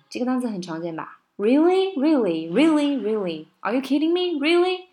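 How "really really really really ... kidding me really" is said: higher, louder disbelieving "really?"; incredulous really. Shown as a demonstration. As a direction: swing really up and down in mock disbelief. Acting out the common word really.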